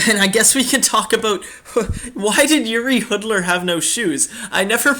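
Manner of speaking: high-pitched, breaking into laughter; laughing. Real, not acted. Host bringing up a player with no shoes, laughing. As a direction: crack up while asking the question. Cracks up introducing funny topic.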